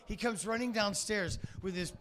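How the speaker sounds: ordinary talk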